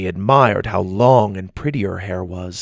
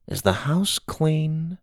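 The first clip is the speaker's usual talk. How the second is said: soft, slow, rising question; guarded question; 7 semitones higher, 6 dB quieter, slower than usual. A narrator's reading. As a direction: ask carefully with raised pitch. Grandma first asks in code.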